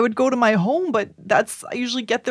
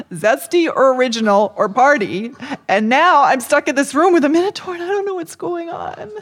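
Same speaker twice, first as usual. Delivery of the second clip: flustered rant; 6 semitones higher, 5 dB louder than usual. Acted.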